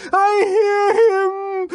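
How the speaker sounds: very high, loud, slow cry; a woman crying out in frenzy; ecstatic cry. Acted. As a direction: cry out in rapture. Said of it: "she cried".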